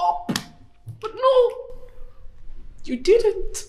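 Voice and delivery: soft, hurt reproach; tearful hurt. Acted. Wife to husband who missed the anniversary dinner, tearful hurt. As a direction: keep it low and wounded, lightly tearful. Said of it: "Why are you crying?".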